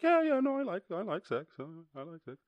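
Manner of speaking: squeaky, dopey mock confession; silly high voice. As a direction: play the dopey friend. Voicing panda friend.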